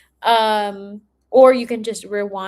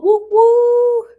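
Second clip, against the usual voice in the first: high, loud little whoop; excited cheer; 14 semitones higher, 10 dB louder than usual. Real, not acted. Host cheering a viewer tuning in from Seattle, excited. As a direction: cheer for them like a fan.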